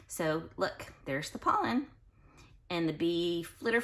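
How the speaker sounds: ordinary delivery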